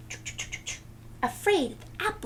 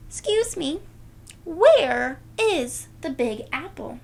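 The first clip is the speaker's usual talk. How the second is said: high, loud polite question; a girl asking the farmer politely; Nellie asking; 5 semitones higher, 6 dB louder than usual. Acted. Nellie asking the farmer, high and loud. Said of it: "Nellie asks".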